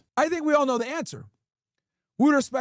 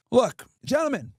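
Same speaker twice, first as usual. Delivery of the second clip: normal talking voice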